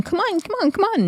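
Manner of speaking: rapid-fire falsetto mimicry; very high-pitched mocking imitation; mock taunting. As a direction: throw their goading back in a high voice. Mimicking Fauna's taunts back at her.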